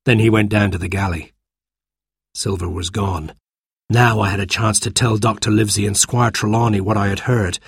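ordinary delivery

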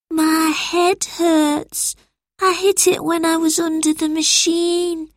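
put-on a dazed injured child; hurt boy Albert.

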